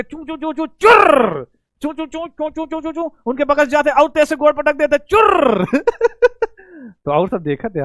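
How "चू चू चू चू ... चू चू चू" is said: squeaky shoe noise. Retold or imitated. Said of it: "जोर से गोड़ पटक दे".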